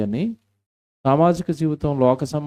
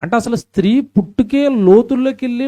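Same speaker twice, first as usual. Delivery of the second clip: loud, much higher preaching cry; impassioned preaching; 11 semitones higher, 7 dB louder than usual. Acted. Pastor preaching on woman's origin, loud and high. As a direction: build to a fervent peak.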